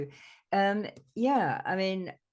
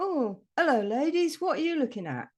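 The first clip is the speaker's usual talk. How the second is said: lightly voiced passer-by, higher and louder; hailing bloke; 7 semitones higher, 5 dB louder than usual. Bloke greeting two women at the stile, hailing. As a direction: imitate a man cheerily hailing two walkers. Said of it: "And the bloke goes".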